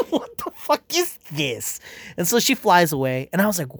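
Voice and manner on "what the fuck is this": high, slow incredulous cry; mock outrage. Fan reacting to the movie, high slow outraged disbelief. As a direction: stretch it out, baffled and appalled. Retelling own shocked reaction.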